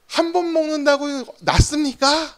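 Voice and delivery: mock indignant outburst, slow and high-pitched; retold angry yell. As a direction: imitate a doctor yelling at a patient. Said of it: "막 이렇게 소리를 지르는 거예요".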